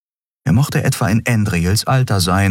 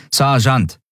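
correcting; 8 semitones higher than usual. A narrator's reading. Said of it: "verbesserte die Frau".

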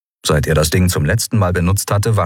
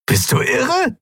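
high, disbelieving squeal; incredulous; slower than usual. A narrator's reading. Olim to Sephiron, high incredulous disbelief. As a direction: ask as if he has lost his mind. Olim questions his sanity.

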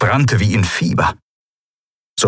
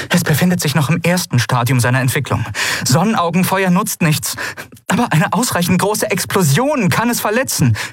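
higher, fast, pressing warning; scared woman rattling off a hurried explanation; urgent; 9 semitones higher than usual. A narrator's reading. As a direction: rush it out in urgent alarm. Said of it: "nichts von ihrer Dringlichkeit verloren".

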